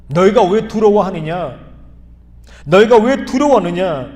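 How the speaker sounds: loud rebuking voice of Jesus. Acted. Jesus rebuking his fearful disciples, loud stern voice. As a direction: scold the disciples firmly and loudly. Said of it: "제자들을 꾸짖습니다".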